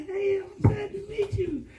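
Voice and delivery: high, laughing shout; laughing exclamation. Retold or imitated. Husband greets the car dealer, laughing.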